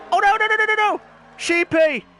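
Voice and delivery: high, loud cry of alarm; alarmed shout. Real, not acted. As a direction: yell as the car slides. Car nearly crashes.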